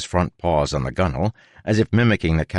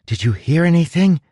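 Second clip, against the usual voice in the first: put-on young girl voice; 12 semitones higher, 5 dB louder than usual. A narrator's reading. Tress speaking.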